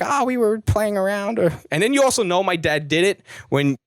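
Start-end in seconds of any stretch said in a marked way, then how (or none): 0.0-1.4 high, slow caught-out excuse; mock parent excuse. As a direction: fake innocence, pitched up and slow. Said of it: "it'd just be like".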